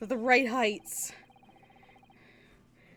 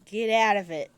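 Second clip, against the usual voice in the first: mock protest; 8 dB louder than usual. Real, not acted. Player reacts to a pun.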